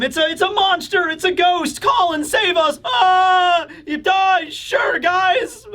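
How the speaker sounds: high, panicky cartoon cries; hammed-up squeals of kids begging for rescue; mock terrified children. Children begging Colin to save them from a ghost, mock panic. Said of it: "all the children are scared".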